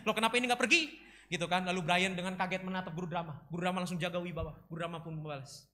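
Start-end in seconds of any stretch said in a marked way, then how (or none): 0.1-0.9 alarmed. Acted. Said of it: "Guru dramanya hampir kena serangan jantung".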